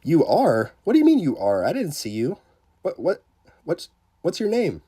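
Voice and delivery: high, loud disbelief; real surprise at a viewer; surprised. Real, not acted. Streamer confused by a chatter.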